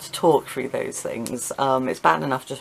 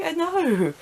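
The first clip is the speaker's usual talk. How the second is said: high, drawn-out "know"; exasperated mock reply; 14 semitones higher than usual. Patient answering over-explaining hospital staff, mock exasperation. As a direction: stretch it high as if told the obvious.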